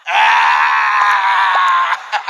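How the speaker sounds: hammed-up chase with a yell and cackles; SpongeBob chasing kids. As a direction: play the costume guy chasing the kids, hollering. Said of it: "This is how SpongeBob was running".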